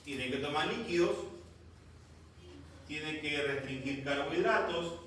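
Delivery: ordinary delivery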